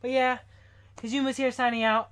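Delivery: loud, breathless sign-off; gasping for air at three AM; out of breath. Real, not acted. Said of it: "I'm running out of breath".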